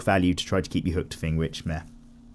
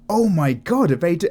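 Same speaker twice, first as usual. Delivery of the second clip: higher and louder, breathless excitement cut short; excited disbelief; 7 semitones higher, 4 dB louder than usual. Retold or imitated. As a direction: gush as if the hope just came true. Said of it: "I was just like".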